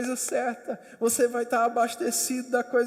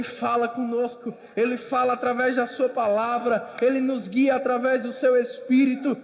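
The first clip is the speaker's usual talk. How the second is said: preaching at full force; 4 semitones higher, 9 dB louder than usual. Acted. Preacher exhorts the congregation to persevere, loud and rising. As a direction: preach it out to the hall.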